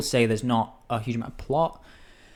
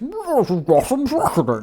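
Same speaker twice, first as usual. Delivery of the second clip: mumbled, loud, higher impression; mumbled Tom Hardy impression; 11 semitones higher, 5 dB louder than usual. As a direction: mumble it like Mad Max. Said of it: "doesn't even know what he sounds like".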